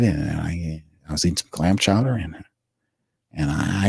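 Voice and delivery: soft, stumbling, hesitant mumble full of "you know"s; mocking Mickey Loomis. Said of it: "This is how it's supposed to sound".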